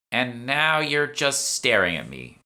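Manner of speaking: put-on high, slow, loud irritated young woman; irritated young shopper. Lisa annoyed at the staring narrator, high and slow. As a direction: let the annoyance stretch out.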